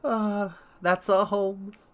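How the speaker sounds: high, slow, doting aside; fond and affectionate. Real, not acted. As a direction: savour the moment like a fan. Fond remark on the character.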